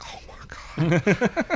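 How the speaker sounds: drawn-out whispered dismay; hushed, mock-horrified groan; dismayed gasp. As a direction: whisper it slowly, appalled. Pop's dismayed reaction, retold.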